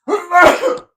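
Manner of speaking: loud, high sudden sneeze; sneezing. Real, not acted. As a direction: let a big sneeze burst out. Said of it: "I think I'm gonna sneeze".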